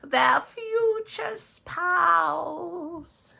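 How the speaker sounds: high, slow theatrical wizard chanting a spell; cackling sorcerer casting a spell, very high and loud. Acted. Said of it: "Mister Wizard?".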